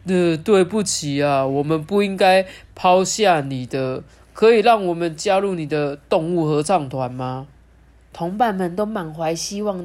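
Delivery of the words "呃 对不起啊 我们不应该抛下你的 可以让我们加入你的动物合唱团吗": tearful apology. Acted. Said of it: "也泪汪汪的说".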